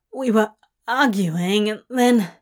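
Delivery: halting, confused, mildly panicked; panicked, sleepy haze. A narrator's reading. Crowley half awake after the nightmare, mild panic. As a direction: grope for what just happened. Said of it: "through the haze of panic and sleep".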